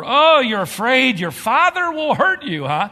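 high, loud mocking jab; sarcastic taunt. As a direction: taunt her with a sneer. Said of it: "responded sarcastically".

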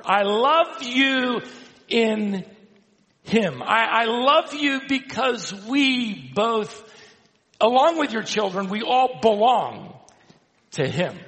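high, loud, fervent pulpit voice; impassioned preacher in full flow; emphatic preaching. Real, not acted. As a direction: proclaim it with long, leaning stresses. Preaches the verse's meaning.

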